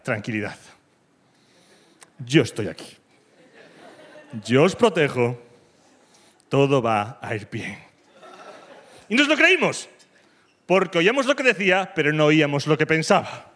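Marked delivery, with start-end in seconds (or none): 0.0-7.8 low, slow, calm presidential reassurance; Zapatero reassuring. Retold or imitated. President on television calming the country, slow and low. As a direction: soothe the nation slowly and low. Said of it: "Zapatero ... nos decía".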